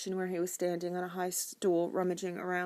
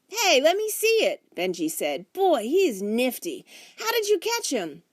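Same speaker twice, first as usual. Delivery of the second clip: put-on excited kid voice; 8 semitones higher, 7 dB louder than usual. A narrator's reading. Boy admires frog.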